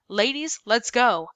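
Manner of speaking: a stern caseworker barking an order. A narrator's reading. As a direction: bark the girls into line. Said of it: "Miss Smirt ordered".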